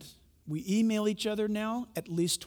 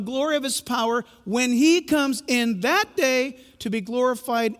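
raised, louder preacher's reading; scripture reading; 4 semitones higher, 4 dB louder than usual. Acted. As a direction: proclaim the verse.

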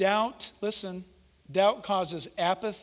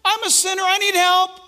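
high, loud cry for help; desperate plea; 12 semitones higher, 8 dB louder than usual. Acted. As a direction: cry out like a convicted sinner. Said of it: "And they said".

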